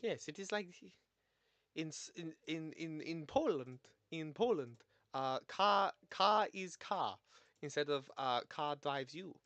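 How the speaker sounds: halting, emphatic put-on Polish accent; attempted Polish accent. Learner practising how Poland differs from Russia, mock Polish accent. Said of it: "Polish accent like this".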